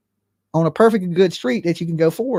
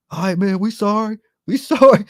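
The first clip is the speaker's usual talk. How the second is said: sheepish apology; 4 semitones higher than usual. Retold or imitated. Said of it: "they were like".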